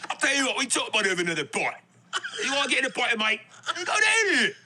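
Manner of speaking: a put-on cockney threat, rising high; hammed-up British tough talk; mock London accent. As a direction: play a cocky London bloke offering a fight. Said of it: "your accent is crazy right now".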